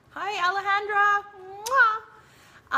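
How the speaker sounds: high, loud gushing hello and kiss. Real, not acted. Streamer greeting a viewer with a kiss, gushing. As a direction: blow a kiss down the mic.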